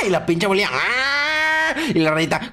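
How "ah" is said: high, drawn-out mock "ah"; imitated scream. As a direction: mimic the noise it makes. Imitates game noise. (grito agudo imitado)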